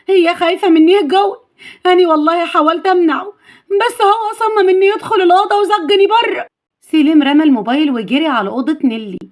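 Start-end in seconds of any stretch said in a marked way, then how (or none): 0.1-6.5 high, distressed maid's voice; acted anxious tearful tale-telling; worried, upset. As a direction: report the danger in a pleading high pitch. Maid reports danger in alarm.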